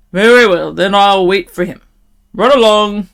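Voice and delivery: loud, grudging troll. A narrator's reading. Troll letting the little goat go, loud. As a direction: dismiss the goat loudly as a troll. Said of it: "the troll in his great loud voice".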